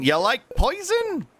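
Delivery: high, slow teasing voice; high silly voice. Shown as a demonstration. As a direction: squeak it out slowly. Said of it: "repeated in new voices".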